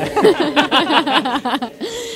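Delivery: high burst of laughter; loud laugh. Real, not acted. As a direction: crack up at your own pun. Laughing at own joke. (carcajada aguda tras su propio chiste)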